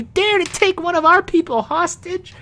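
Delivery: very high squeaky outrage, stress on our; indignant halfling man. Acted. Halfling man correcting himself to the orcs, high indignant squeak. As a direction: hit our hard in a shrill little voice. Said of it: "no, he says".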